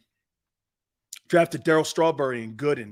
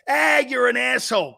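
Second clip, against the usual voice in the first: raised, higher-pitched mock jeer; mock heckle; 6 semitones higher, 5 dB louder than usual. Host imitating guys who rib him every day, mock heckle.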